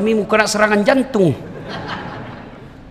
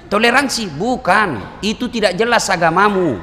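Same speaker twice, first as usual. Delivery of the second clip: ordinary talk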